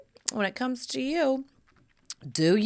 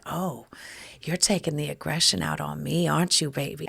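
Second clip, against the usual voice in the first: lower, sultry teasing; flirting with husband mid-lovemaking, lower and teasing; low flirty tease; 7 semitones lower than usual. Retold or imitated. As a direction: purr it at him, pitched lower. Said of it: "And I said".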